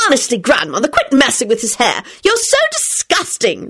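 high-pitched, understated screech, slowing at the end. A narrator's reading. Rosie scolding Grandmother for messing with his hair, screeching. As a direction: climb to a high screech on the insult.

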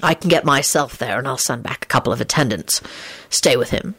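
low, stern man's voice. A narrator's reading. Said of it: "he told her sternly".

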